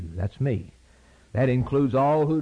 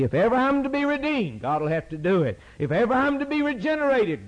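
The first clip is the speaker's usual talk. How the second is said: preaching climax; 10 semitones higher than usual. Real, not acted. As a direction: build the fervour to God Almighty. Climactic preaching build, repeated refrain.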